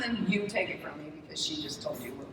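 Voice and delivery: ordinary talk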